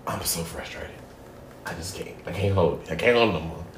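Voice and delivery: 1 hushed frustration. Retold or imitated. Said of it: "and then I was like".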